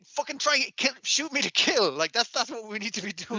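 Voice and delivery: mock drill instructor; mock teacher. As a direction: bark the order like a crazed trainer. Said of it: "it's like".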